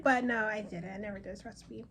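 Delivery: ordinary delivery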